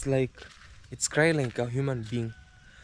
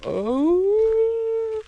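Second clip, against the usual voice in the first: high mournful howl, stretched out; imitated dog howl; 4 dB louder than usual. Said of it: "so it's crying like".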